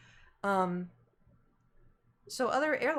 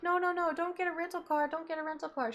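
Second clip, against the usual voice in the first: higher, insistent mom voice; mom urging; 7 semitones higher than usual. Retold or imitated. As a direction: mimic a worried mother pleading. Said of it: "My mom's like".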